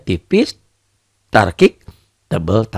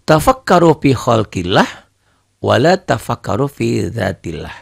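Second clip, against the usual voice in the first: Quran recitation; 3 semitones higher than usual. Shown as a demonstration. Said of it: "Kan dalam Quran dijelaskan".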